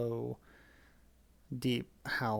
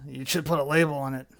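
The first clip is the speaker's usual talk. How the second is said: lightly voiced werewolf, louder; a hint of werewolf growl; 7 dB louder than usual. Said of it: "Just pretend like it's a werewolf voice".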